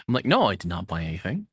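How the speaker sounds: ordinary talk, nothing marked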